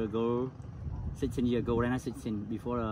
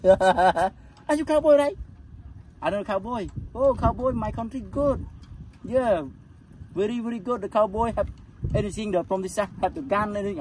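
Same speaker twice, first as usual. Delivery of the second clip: tourist voice; 9 semitones higher, 5 dB louder than usual. Retold or imitated. Stranger praising cowboys, voiced.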